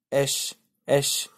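एश stretched very slowly, the sh held long; slow the एश right down so the sh sound is clear; teacher isolating the sh in flash; drawn-out sound demo. Shown as a demonstration. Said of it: "इसमें ना ऐसे आएगा sound".

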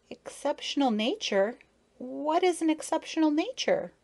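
high, puzzled little questioner; shy child. A narrator's reading. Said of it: "she asked shyly".

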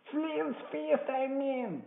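high, impatient outburst; cursing cry. A narrator's reading. Blind man cursing at his man upstairs, impatient cry. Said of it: "he cried".

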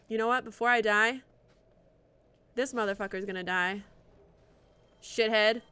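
ordinary delivery

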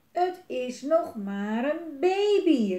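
loud, high, drawn-out plea; calling out. A narrator's reading. Twins plead for the baby dragon, calling out. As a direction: plead loudly as children, stretching words. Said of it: "riep de tweeling".

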